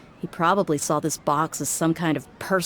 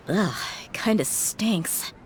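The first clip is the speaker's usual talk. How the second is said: slow, whispered ugh of disgust; slow hushed disgust at a bad smell; 3 semitones higher, 4 dB quieter, slower than usual. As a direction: wrinkle your nose and whisper it. Smelly box contents.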